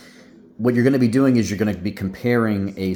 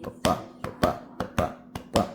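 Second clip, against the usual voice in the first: Ba ba ba whispered fast, like a pulse; like a heartbeat; faster than usual. Shown as a demonstration. Teacher showing students the meter mimics a heartbeat, fast whispered pulse. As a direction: breathe out a quick heartbeat rhythm. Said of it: "it's supposed to mimic your heartbeat".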